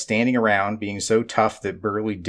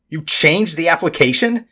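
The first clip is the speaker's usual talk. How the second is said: bellowing; 7 semitones higher, 3 dB louder than usual. A narrator's reading. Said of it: "Mister Fitzroy bellowed".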